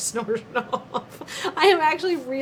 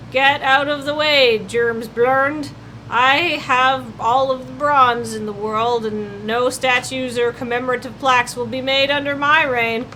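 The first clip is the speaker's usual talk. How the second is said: villain voice; 2 semitones higher, 6 dB louder than usual. Acted. Villain persona gloating.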